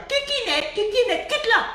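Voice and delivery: high, sing-song teasing; high sing-song mockery; mocking tease. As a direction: taunt him in a high voice.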